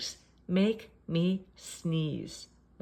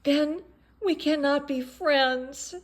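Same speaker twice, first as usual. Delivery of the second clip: mock-tragic lament; 6 semitones higher, 6 dB louder than usual. Gerald's dramatic lament.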